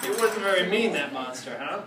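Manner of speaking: plain reading voice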